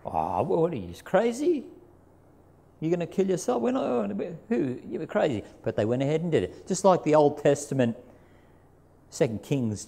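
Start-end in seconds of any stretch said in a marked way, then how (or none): 0.1-5.4 higher, mocking voice of a scornful crowd; scoffing doubter. The crowd scoffing at Jesus, mocking voice. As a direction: sneer as if he's lost his mind.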